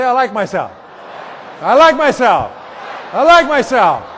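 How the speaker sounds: rising to an excited shout; loud affirmation. Shown as a demonstration. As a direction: proclaim it loudly to the room. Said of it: "Say it".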